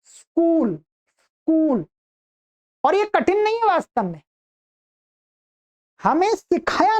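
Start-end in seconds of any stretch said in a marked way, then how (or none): 0.0-1.9 स्कूल said slowly and clearly, s+k cluster; correct pronunciation. Shown as a demonstration. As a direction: model स्कूल for students, no vowel before स. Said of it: "क्या". ("स्कूल" धीरे और साफ़, बिना "इ" या "अ" के)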